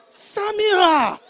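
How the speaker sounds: loud, high, drawn-out shout of a name; shocked call-out. Retold or imitated. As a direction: holler the name in comic reproach. Calling out Samira in shock.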